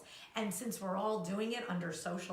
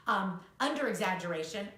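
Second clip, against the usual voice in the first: ordinary talk, nothing marked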